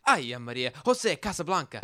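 rising, playful mock outburst; mock Spanish exclamation. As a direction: pitch it up as a jokey Spanish rant. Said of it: "we'd be like".

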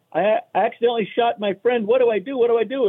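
high, stammering joke-caller panic; mock panic. Idiot hunter who shot his friend, mock panic. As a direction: plead for help in hammed-up alarm.

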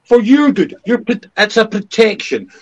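high, loud mock official spin; sarcastic Newspeak mockery. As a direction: sneer it like government doublespeak.

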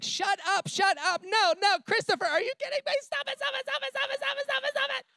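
very high squealing disbelief, repeated fast; imitated excited screaming. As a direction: squeal like she can't believe the proposal. Girlfriend reads fortune, runs around screaming.

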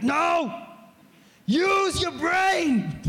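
he yells at friends wanting to google it; shout. Retold or imitated. Preacher retelling how he yells at friends, shouting.

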